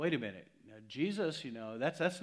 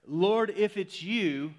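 mock panicked outburst, higher, louder and slow; fearful blurt; 7 semitones higher, 8 dB louder, slower than usual. Peter calling to Jesus from the boat, mock panicked outburst. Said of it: "he blurts out, out of fear".

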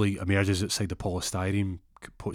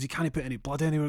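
high, fast protest; anxious friend; 8 semitones higher, faster than usual. Acted. Friend protecting his house from blood, high hurried protest. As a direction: fret quickly in a raised voice.

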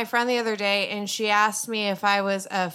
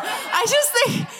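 high incredulous; 13 semitones higher, 3 dB louder than usual. Acted. Absurd theory build.

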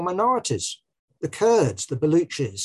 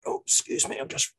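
strained, stretching up while talking; reaching up and straining; 6 semitones higher, 4 dB quieter than usual. Real, not acted. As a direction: talk while reaching for something. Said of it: "I'm just reaching up to get it".

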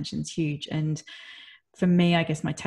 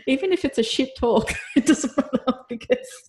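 laughing joy; 7 semitones higher, 4 dB louder than usual. Real, not acted. Still overjoyed after son's text.

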